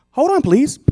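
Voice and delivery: high-pitched phone agent. Acted. Agent puts caller on hold.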